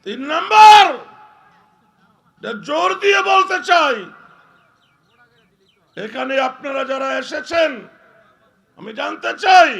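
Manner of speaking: thundering high sermon shout; preaching at full force. Acted. Preacher to the gathered crowd, thundering sermon shout. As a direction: roar it out to the whole crowd.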